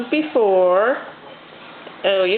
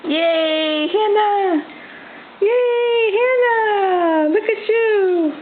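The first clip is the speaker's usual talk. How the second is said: excited cheering; 8 semitones higher, much slower than usual. Real, not acted. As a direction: cheer her on, up high. Cheering baby standing up.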